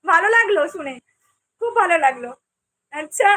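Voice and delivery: ordinary delivery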